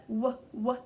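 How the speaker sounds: "w" said as a short "wuh", twice; letter sound demo. Shown as a demonstration. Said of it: "w sound at the beginning".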